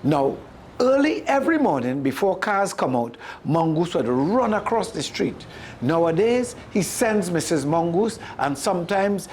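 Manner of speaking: ordinary delivery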